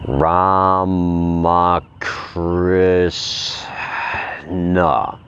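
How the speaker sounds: each syllable drawn out, very slow. Shown as a demonstration. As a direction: stretch Ramakrishna out syllable by syllable. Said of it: "I'm gonna say it real slow".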